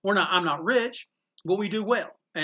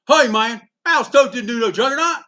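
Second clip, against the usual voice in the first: loud, raised voice of a heckling fan; challenging big guy; 4 semitones higher, 10 dB louder than usual. Retold or imitated. Big fan disputing a storyteller at the artist's table, acted challenge. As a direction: imitate a big fan butting in, loudly. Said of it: "the big guy goes".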